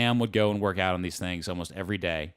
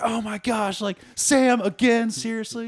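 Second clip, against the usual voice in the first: high, loud exasperated cry; mock disbelief at a repeat offender, pitched way up; exasperated outburst; 7 dB louder than usual. Acted reaction to wet clothes.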